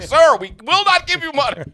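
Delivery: high-pitched mock bank employee; exasperated bank teller. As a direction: act out a bank turning someone away. Acted bank reply to screaming customer.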